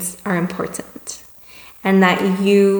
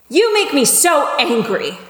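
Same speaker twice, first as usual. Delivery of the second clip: higher-pitched mock complaint; acted angry; 7 semitones higher than usual. Someone comes to us with a complaint, acted angry. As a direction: throw the blame at them, hammed-up. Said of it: "if someone comes to us and says".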